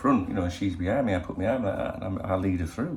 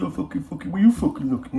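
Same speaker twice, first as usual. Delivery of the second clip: high aggro lad shouting, louder by the end; mock young punk; 9 semitones higher, 6 dB louder than usual. To punks in the bar, mock lairy challenge. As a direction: mimic a lairy lad squaring up.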